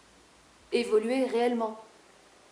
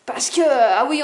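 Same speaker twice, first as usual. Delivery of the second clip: ordinary talk, nothing marked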